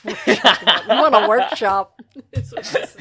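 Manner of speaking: loud, laughing through the words; helpless, noisy amusement; real laughing. Real, not acted. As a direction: crack up while talking. Cracking up at their own rambling.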